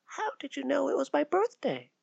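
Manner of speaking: put-on high, soft child's question; curious child amazed; wide-eyed girl asking; surprised little girl. Mary voiced as child.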